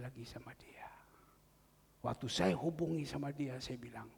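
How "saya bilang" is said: ordinary delivery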